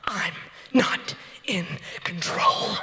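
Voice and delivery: slow, voiceless straining; low, effortful acted gasp; strained whisper. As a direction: force it out in a whisper while pushing. Retold loss of control while pushing.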